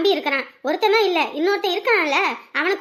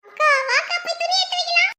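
put-on excited child call; 9 semitones higher than usual. Child calls sister excitedly.

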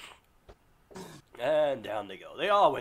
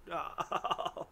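usual speaking voice

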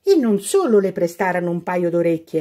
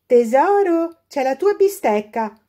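high, coaxing call; granny coaxing her to eat; grandma calling; 8 semitones higher, 4 dB louder than usual. A narrator's reading. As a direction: coax the girl with her steak. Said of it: "le diceva la nonna".

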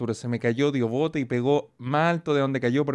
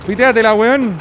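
loud hyped call; loud gamer shout; 5 semitones higher, 7 dB louder than usual. Real, not acted. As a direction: yell it at the screen.

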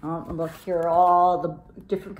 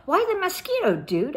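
character voice; 10 semitones higher than usual. Acted. Paquito Tito voiced.

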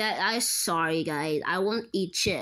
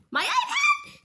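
much higher pitched alarmed cry; shocked squeal; 4 dB louder, slower than usual. Acted. Friend learns his iPad was taken, high shocked squeal. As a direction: squeak in disbelief.

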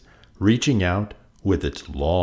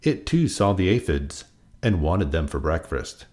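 plain reading voice

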